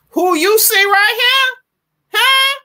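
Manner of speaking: imitating grandma's high challenge. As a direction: channel your grandma asking. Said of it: "that's how my grandma talks".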